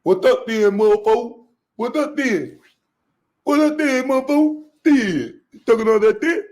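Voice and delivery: high, loud street-tough challenge; tough Shannon impression. Shannon confronting Mike Epps, mocked as loud and tough. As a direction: bark it like jumping out the car. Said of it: "This is how Shannon gonna jump out the car".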